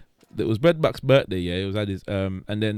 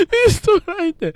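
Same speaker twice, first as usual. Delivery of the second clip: laughing. Real, not acted. Laughing while telling story.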